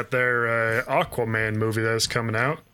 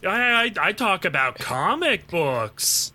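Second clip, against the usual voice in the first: higher, slow mock-angry Boston rant; a Bostonian firing back, pitched up and indignant; 7 semitones higher, slower than usual. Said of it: "sent an angry video back".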